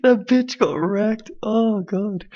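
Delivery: slow, high-pitched squeal after a laughing fit; spent from laughing. Real, not acted. Host gloating over the debate clip, spent from laughing. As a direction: stretch it out, voice pushed high by laughter. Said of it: "I'm out of breath from laughing".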